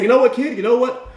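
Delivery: high, punchy fake enthusiasm; mock enthusiasm. Boss brushing off eager interviewee, falsely enthusiastic. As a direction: pitch it high, as if suddenly keen.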